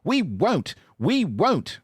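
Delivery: high, shrill screaming refusal; screaming trucks. Acted. Trucks refusing to be pulled, screaming high. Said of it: "screamed the trucks".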